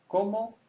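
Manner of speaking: ¿Cómo? high right from the start; high-pitched CÓMO. Shown as a demonstration. Teacher repeats the question tune for learners, CÓMO high. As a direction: lift the whole of Cómo high. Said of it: "high pitch because it's a question".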